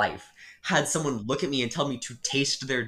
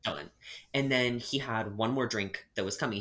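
usual speaking voice

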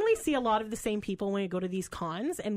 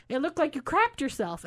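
blurted out; 6 semitones higher than usual. Retold or imitated. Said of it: "Did I just say that out loud?".

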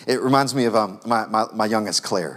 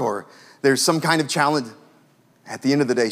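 ordinary talk, nothing marked

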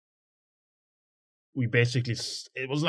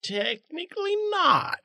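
sheepish squeak; slower than usual. Real, not acted. Player's sheepish dodge.

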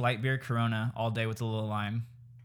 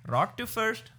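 high, hollered imitation of an Indian dad coaching; hammed-up high coaching shout; mock Indian baseball dad; 9 semitones higher than usual. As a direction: put on an Indian dad calling the plays. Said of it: "Indian, like".

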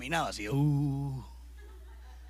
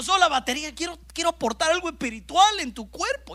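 prayer building to a cry; 5 dB louder than usual. Retold or imitated. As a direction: pour it out, from a murmur to a desperate cry. Retold frustrated prayer to God.

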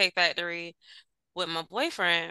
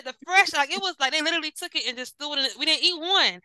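higher, rushed indignant complaint; indignant retelling; 6 semitones higher than usual. Retold or imitated. Complaining to boyfriend about tossed egg rolls, indignant. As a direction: pile up the grievance fast. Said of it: "I said".

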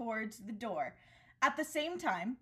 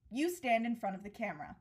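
normal talking voice

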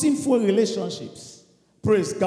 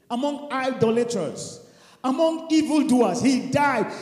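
ordinary talk, nothing marked

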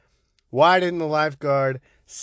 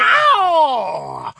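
falsetto yelp; 14 semitones higher, 5 dB louder than usual. Pretend. Intro flourish yell.

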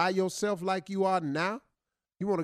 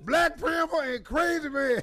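crazy man voice; 8 semitones higher than usual. Pretend. Crazy man character talking.

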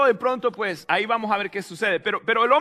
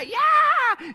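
very high, drawn-out yell; wild cheer; 14 semitones higher than usual. Acted. Preacher imitating churchgoers let loose at the disco, wild cheer. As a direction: let rip like a fan in the stands.

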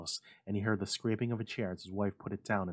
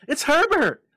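even higher, louder scream; 18 dB louder than usual. A narrator's reading. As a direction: scream it with everything. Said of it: "she screamed".

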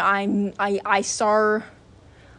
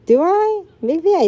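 a light squeaky chipmunk-style "Do I?", much higher; Alvin voice; 9 semitones higher, 6 dB louder than usual. Pretend. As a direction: pitch it way up like a chipmunk asking. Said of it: "Do I sound like Alvin?".

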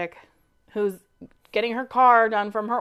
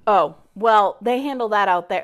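ordinary talk

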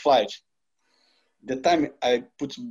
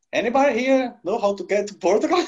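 clueless bus driver; 9 semitones higher than usual. Retold or imitated. Lost Spanish bus driver asks.

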